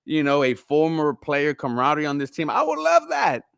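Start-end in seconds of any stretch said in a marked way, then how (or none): 2.5-3.4 excited. Real, not acted. Excited high exclamation.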